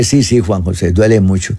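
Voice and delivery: voz mucho más grave, abatida; tristeza imitada. Retold or imitated. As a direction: imitando a un hombre dolido, hundido. Taxista admite que le duele. (el taxista confiesa su dolor en voz grave)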